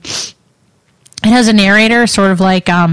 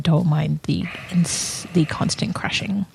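whispered aside; 2 semitones lower, 10 dB quieter than usual. Real, not acted.

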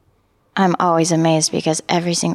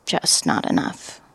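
much lower, slow, dismissive voice; lowered, sneering inner critic; 9 semitones lower, slower than usual. Retold or imitated. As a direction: drop low and belittle like the critic. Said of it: "my inner critic automatically said".

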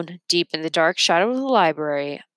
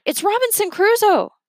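excited yell; 14 semitones higher, 4 dB louder than usual. A narrator's reading. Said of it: "Leo yelled down".

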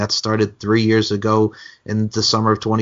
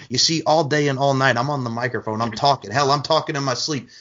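pumped-up trash-talk promo, a bit higher; wrestling promo; 4 semitones higher than usual. Pretend. Wrestler trash-talking the interviewer in a spontaneous promo. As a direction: cut a cocky promo on your opponent.

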